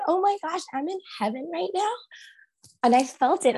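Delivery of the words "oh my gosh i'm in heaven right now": much higher, giddy disbelief; amazed. Retold or imitated. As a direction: squeal it like you just realised you're in heaven. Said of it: "I was like".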